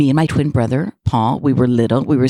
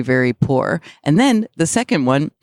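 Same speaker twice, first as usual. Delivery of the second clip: ordinary delivery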